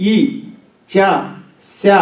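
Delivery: ఈ, చ, శ said one by one on the palate; palatal sounds. Shown as a demonstration. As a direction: isolate each palatal, ఈ, చ, శ, with pauses. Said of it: "అవన్నీ తాళవ్యములు".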